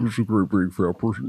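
low, soft creepy voice; low soft killer impression; Buffalo Bill voice. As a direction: keep it all very low. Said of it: "so it's all very low".

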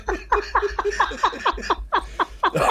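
high-pitched cackle of real laughter; high laugh burst. Real, not acted. Laughing at his own embarrassment to his mate. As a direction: let the laugh break out high.

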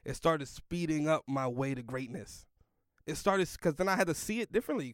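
ordinary delivery

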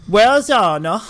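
Buongiorno in a mock southern American accent; southern-accent Italian. Friend's attempt at gibberish Italian, mock southern American accent. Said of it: "I was trying to do a southern accent".

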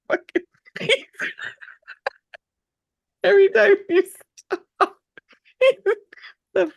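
high squealing, near tears of delight; overwhelmed by cuteness. Real, not acted. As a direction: gush over the cute dog. Squealing over the dog.

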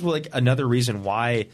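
normal talking voice

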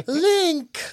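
very high, weird princess voice; Zelda. Retold or imitated. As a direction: mimic an oddly spoken game heroine. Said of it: "She just speaks in a really weird way".

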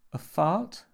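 high, loud, rising question; baffled guess. A narrator's reading. Jimmy answers the monk's question, high and baffled. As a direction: offer it as a puzzled guess.

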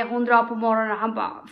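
usual speaking voice

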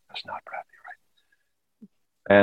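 hushed whispered aside. Shown as a demonstration. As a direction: drop to a breath-only whisper. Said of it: "but that's still vibrating".

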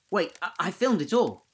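high, loud, tripping over his words; eager to show what he caught; excited stammer. A narrator's reading. Tom blurting to friends about his video, high excited stammer.

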